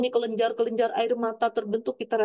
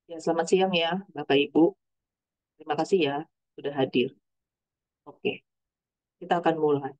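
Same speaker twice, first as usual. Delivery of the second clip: lower, dull greeting with no feeling; greeting without emotion; 7 semitones lower than usual. Shown as a demonstration. Speaker greets the audience to show talk without emotion, lower and dull. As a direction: read it out like a chore, nothing behind it. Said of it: "Bedakan dengan misalnya saya gini".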